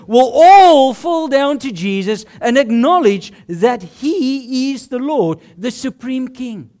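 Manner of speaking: fervent preacher's climax; preaching at full force. Acted. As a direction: drive it home to the congregation.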